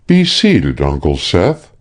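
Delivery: ordinary delivery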